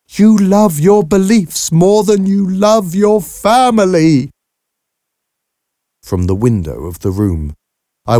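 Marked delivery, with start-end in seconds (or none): 0.1-4.3 slow, high, loud bitter accusation. A narrator's reading. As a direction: hurl the reproach out slowly, voice raised. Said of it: "hear the bitterness in Harriet's voice".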